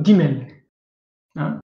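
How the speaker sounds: ordinary talk, nothing marked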